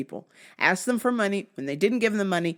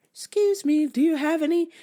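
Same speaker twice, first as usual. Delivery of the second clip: higher, polite-sounding mock ask; panhandler's request; 7 semitones higher than usual. Man in car asks walker for money, pitched-up pleading. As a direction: imitate a stranger asking for money, pitched up.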